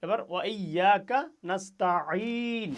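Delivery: নাস্তাইন slow, আইন squeezed in the throat; pressed ain. Shown as a demonstration. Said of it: "এখানে আইনে চাপ হবে".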